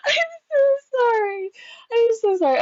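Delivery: high, tearful laughing apology; squeaky words squeezed through laughter; helpless laughing. Real, not acted. Apologizes during laughing fit.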